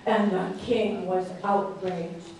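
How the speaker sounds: usual speaking voice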